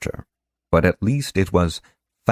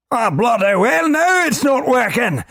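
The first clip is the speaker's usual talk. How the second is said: much higher, louder angry little-man voice; shouting little man; 4 dB louder than usual. Acted. Globin shouting at Korash, high and angry. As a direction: bellow it like a tiny furious minder. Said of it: "shouted the diminutive man".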